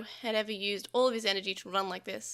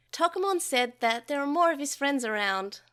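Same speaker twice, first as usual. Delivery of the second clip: put-on high, lively child voice; excited; 4 semitones higher, 4 dB louder than usual. A narrator's reading. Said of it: "Takeru said excitedly".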